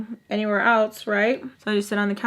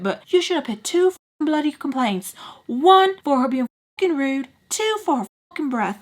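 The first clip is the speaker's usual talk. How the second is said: Charlie ranting; 5 semitones higher than usual. Retold or imitated. Charlie insisting on two complaints, higher and heated. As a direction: keep the mock outrage going.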